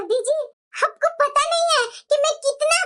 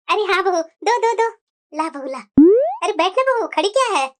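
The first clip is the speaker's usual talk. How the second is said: lower, sharp-tongued granny voice; old grandmother; 6 semitones lower than usual. Acted. Grandmother talking to her daughter-in-law, low and sharp. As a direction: play a scolding old grandmother.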